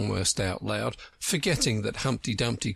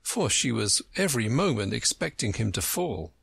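usual speaking voice

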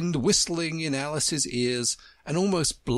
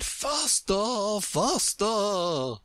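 high, drawn-out Queen's cry; mild cry urging someone on; 8 semitones higher, much slower than usual. A narrator's reading. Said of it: "still the Queen cried".

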